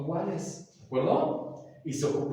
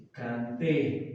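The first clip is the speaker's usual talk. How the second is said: "canté" with the accent landing on the final é; stress on final E; 4 semitones lower than usual. Shown as a demonstration. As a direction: stress the last syllable of "canté". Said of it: "Acento en la E". ("canté" con acento en la é final)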